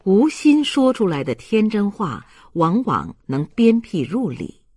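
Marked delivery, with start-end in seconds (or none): none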